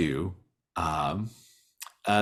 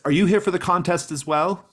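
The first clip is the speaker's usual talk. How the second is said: high, louder eager question; bright welcoming question; 12 semitones higher, 6 dB louder than usual. Acted. Mystic greets the visitor, high and eager. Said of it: "[name] says".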